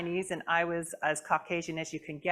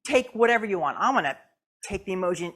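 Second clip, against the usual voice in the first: usual speaking voice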